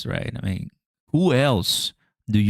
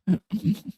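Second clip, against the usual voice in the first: quick high laugh; 10 semitones higher, 4 dB quieter than usual. Real, not acted. Laughs at bomber image.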